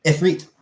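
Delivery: Ifrit said "if-REET"; correct pronunciation. Shown as a demonstration. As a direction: give Ifrit a long "ee" in the second syllable. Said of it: "It's not Ifrit".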